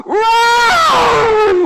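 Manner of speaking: long, high, full-force roar; very loud drawn-out cathartic yell. Retold or imitated. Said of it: "it was like a roar".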